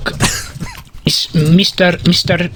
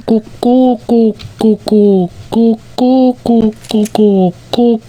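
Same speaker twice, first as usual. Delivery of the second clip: pigeon cooing; 3 semitones higher than usual. Pretend. Pigeon flown into the window, rhythmic cooing. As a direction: coo it as a pretend pigeon. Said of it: "what's he saying? Let's listen".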